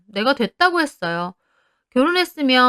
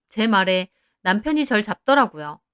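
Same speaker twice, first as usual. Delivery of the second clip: ordinary delivery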